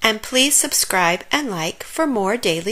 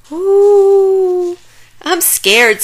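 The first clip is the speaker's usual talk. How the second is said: scared; 11 semitones higher, 8 dB louder, much slower than usual. A narrator's reading. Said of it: "said Pip".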